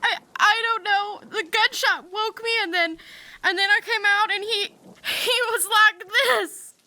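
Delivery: stammering, tearful account; halting and upset, close to crying; distraught. Acted. Woman explaining how she found the body, distraught.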